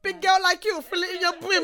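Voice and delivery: high, loud mock-scolding impression; uncle from the meme. Quoting the uncle video to friends, loud mock uncle. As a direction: belt it out as a comic imitation, laughing. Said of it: "that video of that uncle".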